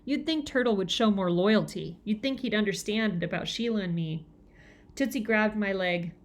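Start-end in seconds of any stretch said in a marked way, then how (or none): none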